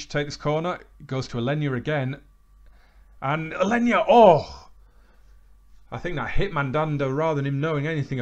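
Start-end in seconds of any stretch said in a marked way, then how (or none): 3.2-4.7 loud rising gasp at the chance; excited shout. Real, not acted. Watching his corner nearly score, an excited shout. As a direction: yell as the ball flashes past.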